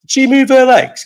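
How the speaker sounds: loud, high-pitched scandalised cry; mock outrage. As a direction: exclaim it as if it were a scandal. Mock outrage at woman on train.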